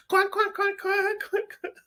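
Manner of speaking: high cartoon quack sounds, laughing at himself; mock quacking chant. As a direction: parrot the chant as a goofy quack. Mimics the song's cuan chant.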